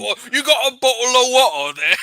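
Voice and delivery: put-on heavy British accent. NPC asks the party for water in heavy British accent. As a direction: ask it like a thirsty British local. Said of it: "heavy British accent".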